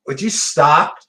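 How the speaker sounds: loud, sudden call to stop; sharp real snap. Real, not acted. Reader cutting off a noisy interruption, loud and sharp. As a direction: break off and bark it at the room.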